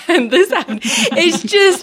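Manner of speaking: words breaking up with laughter; high, giggly, losing it mid-phrase; laughing. Real, not acted. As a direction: crack up at your own impression. Laughs at own mock recital.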